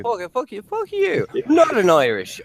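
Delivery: high-pitched mock-indignant Irish; hammy stage-Irish protest; Irish leprechaun accent. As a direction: squawk back in a cartoon Irish brogue. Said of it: "sound like you're selling Lucky Charms".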